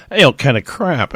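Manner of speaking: normal talking voice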